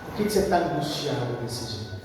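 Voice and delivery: low, soft, calm questioning; Jesus. Acted. Jesus asking Marta about her anguish, calm and gentle. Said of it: "Jesus vira e fala".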